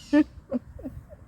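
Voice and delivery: brief high laugh; short laugh. Real, not acted. Parent reacting to the principal's repeated question, short laugh.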